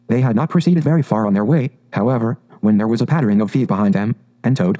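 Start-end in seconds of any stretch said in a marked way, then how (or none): none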